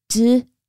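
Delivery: D-S-M-B-E-R sounded out sound by sound. Shown as a demonstration. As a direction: break December into separate sounds. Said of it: "let's sound out the word December".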